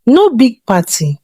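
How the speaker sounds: ordinary delivery, nothing marked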